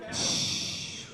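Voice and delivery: a soft breathy shh; playful hush. Real, not acted. Host jokingly hushing the noisy audience, soft shh. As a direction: hush the room like a librarian.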